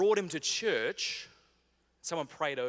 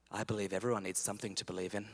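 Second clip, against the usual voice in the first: smug wisdom; 7 semitones lower, 5 dB quieter, faster than usual. Retold or imitated. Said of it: "I said this, ready?".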